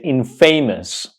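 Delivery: infamous said as in-FAY-mus; mispronounced infamous. Shown as a demonstration. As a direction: say "infamous" like "in" plus "famous", long AY. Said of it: "Do not pronounce this word incorrectly".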